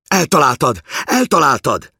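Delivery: put-on very high, loud child's cry; excited shouting. A narrator's reading. Boy cheers his father's hit, shouting high and excited. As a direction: yell it out like a thrilled boy. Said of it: "kiabálta a gyerek".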